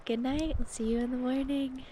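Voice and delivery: ordinary talk, nothing marked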